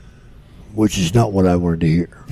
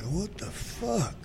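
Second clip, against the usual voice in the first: a slow, soft, higher bewildered mutter; puzzled; 9 semitones higher, 11 dB quieter, much slower than usual. Retold or imitated. Feeling his shoulder vibrate, slow soft bewildered question. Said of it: "I'm like".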